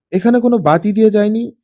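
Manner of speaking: plain reading voice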